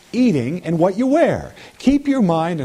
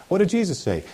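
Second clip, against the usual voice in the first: ordinary delivery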